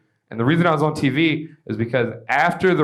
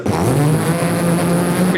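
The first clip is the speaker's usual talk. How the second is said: weed whacker impression; 2 semitones higher than usual. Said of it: "That's a good weed whacker impression".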